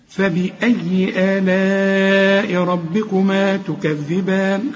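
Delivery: slow, measured tajweed chant; Quran recitation. Shown as a demonstration. Teacher reciting a verse for students, slow Quran recitation. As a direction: recite with long held vowels.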